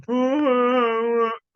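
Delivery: a loud, high real laugh; burst of laughter. Real, not acted. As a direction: laugh out at the absurdity. Laughing at the clip.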